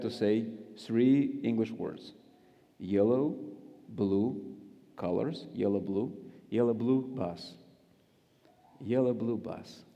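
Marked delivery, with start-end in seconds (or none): 2.8-9.8 "Yellow, blue bus" said like Russian "ya lyublyu vas". Shown as a demonstration. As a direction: run "Yellow, blue bus" together like the Russian for I love you. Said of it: "it sounds for Russian ears like, "I love you".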